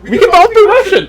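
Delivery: high put-on Russian accent. Agreeing to share the bit, in high mock Russian. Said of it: "You take Russian".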